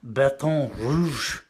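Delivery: very slow, loud French-accented Baton Rouge; exaggerated French accent. Shown as a demonstration. Poet getting closer to the mic to help, loud mock-French accent. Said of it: "Should I get closer?".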